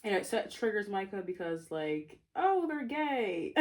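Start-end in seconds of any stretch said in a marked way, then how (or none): 2.3-3.5 high, drawn-out mock gush; gushing realization. Narrator playing up the reveal about the couple, gushing. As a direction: stretch it out in a high, playful squeal.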